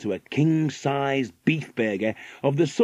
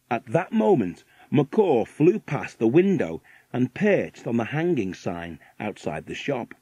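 usual speaking voice

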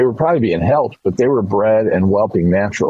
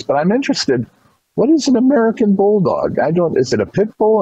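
put-on curious old lady; 9 semitones higher than usual.

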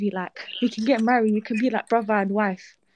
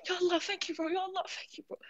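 overwhelmed, near tears; 5 semitones higher, 10 dB quieter than usual. Real, not acted. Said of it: "I'm gonna cry".

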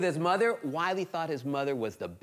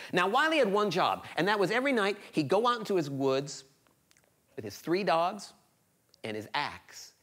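ordinary delivery

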